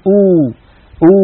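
أو as a long "oo"; أو held as a close "u"; pure Arabic waw. Shown as a demonstration. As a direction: round the lips tightly, أو as "uuu". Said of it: "الحرف العربي".

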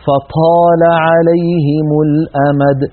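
slow drawn-out "فطال عليهم الأمد" with breathy puff on ط; aspirated ط, a mistake. Shown as a demonstration. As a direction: mimic the error: air escaping after ط in "فطال". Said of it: "ينطقها مهموسة". (تلاوة "فطال عليهم الأمد" بطاء مهموسة)

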